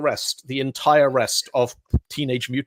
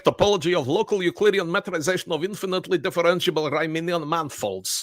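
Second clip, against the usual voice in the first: a light, higher Welsh lilt on a long paper title; amateur Welsh accent, a little raised; 5 semitones higher than usual. Acted. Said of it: "that's my best Welsh accent".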